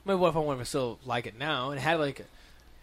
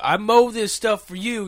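put-on loud, higher and slow old man scolding; old neighbor lecturing the kids, loud and slow; cranky old neighbor; 8 semitones higher, 11 dB louder, slower than usual. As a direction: drag it out like a grumpy neighbor laying down the law. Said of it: "He's like".

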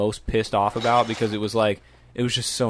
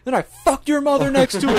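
laughing high taunt. Retold or imitated. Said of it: "he's like".

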